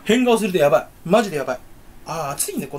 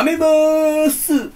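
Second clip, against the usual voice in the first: drawn-out mock announcement; 8 semitones higher, 5 dB louder, much slower than usual. Drawn-out announcement after joke.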